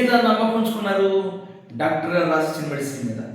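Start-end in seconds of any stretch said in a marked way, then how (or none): none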